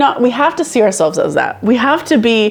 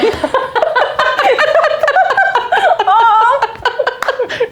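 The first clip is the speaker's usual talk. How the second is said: long high cackle; long high laugh. Real, not acted. Mocking the donut thief, long laugh.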